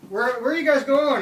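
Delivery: mock call to passing canoes; retold yell. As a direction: yell a question across the water, imitating friends. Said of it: "Greg and Bobby yell out".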